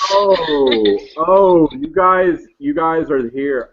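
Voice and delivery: the clerk suddenly realizes who they are; mildly startled stoner clerk; surprised clerk. Acted. Clerk recognizes the brotherhood.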